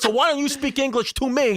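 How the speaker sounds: high, mimicked demand through laughter; Brooklyn mother. Friend's mom demanding English, high laughing mimicry. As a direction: imitate an indignant mom, half laughing. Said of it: "real deal Brooklyn lady smoking cigarettes".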